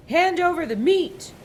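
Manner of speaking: loud, high greedy bark; pirate demand. A narrator's reading. Captain demands meat at dinner, loud high demand. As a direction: demand it with a mouthful. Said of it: "Braidbeard gulped his food and said".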